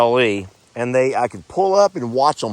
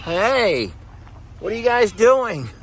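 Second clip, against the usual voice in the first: higher, sing-song voice for animals; 9 semitones higher than usual. Real, not acted. Farmer greeting her goats at the barn in higher cooing baby talk. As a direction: coo at the goats like little babies.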